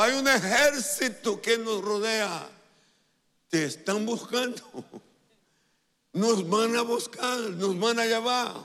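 imitar a un criado que grita alarmado, voz aguda y fuerte; grito asustado. Retold or imitated. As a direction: dar la alarma a gritos, dramatizando. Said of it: "Y él gritó". (grito de pánico actuado)

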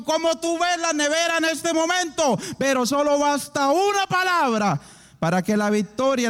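loud, high, rousing proclamation; full-force preaching. Real, not acted. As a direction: build to a loud, driving peak.

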